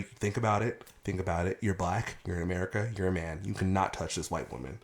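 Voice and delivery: low, hushed reasoning with himself; calm self-talk. Retold or imitated. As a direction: talk yourself down, soft and low. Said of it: "I've been very calm".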